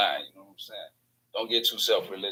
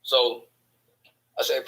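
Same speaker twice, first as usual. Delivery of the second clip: ordinary delivery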